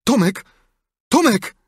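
loud, high-pitched call. A narrator's reading. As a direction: call his name to get an answer. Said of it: "zawołał Sid".

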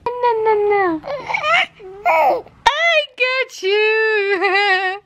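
high sing-song teasing; slow playful sing-song; playful baby talk. Acted. Playing peekaboo with baby.

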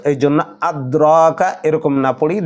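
normal talking voice

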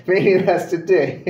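slow words through laughter; laughing. Real, not acted. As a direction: laugh at your own joke. Jokes about empty audience.

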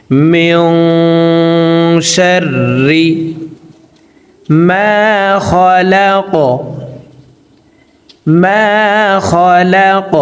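"মিউ" hidden in the nose with ghunna, slow chant; nasal ghunna. Shown as a demonstration. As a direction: hum the n of "মিউ" through the nose. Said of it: "নাকের ভিতরে লুকাইয়া গুন্নার সহিত".

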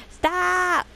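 high-pitched squealed plea; playful protest. Real, not acted. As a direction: cry it out high, vowel stretched. Playful repeated pleas to stop.

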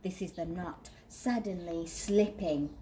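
usual speaking voice

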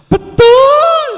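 very high, piercing shouted "betul"; loud shout. Real, not acted. Answering the preacher's call to the mothers, loud high shout. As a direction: yell it out high and long. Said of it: "Ada teriakan keras".

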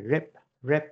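contrasting "rib" with the aspirated "rip"; unaspirated b. Shown as a demonstration. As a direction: close "rib" on a plain b, no breath. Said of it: "âm bờ này không có bật hơi".

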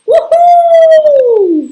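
a loud, very high whooping cheer; Pingu's woohoo. Acted. Pingu flying off the big jump, loud whoop. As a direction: whoop with pure thrill down the jump. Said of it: "went Pingu".

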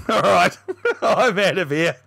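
signing off through laughter; laughing. Real, not acted. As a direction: laugh your way out the door. Laughing sign-off.

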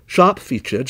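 plain reading voice

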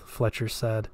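ordinary delivery

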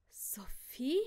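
whispered start, rising sharply at the end; disbelieving question. Acted. Father realises who is in danger, asking in disbelief. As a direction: ask it as if you cannot believe it. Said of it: "fragte er ungläubig".

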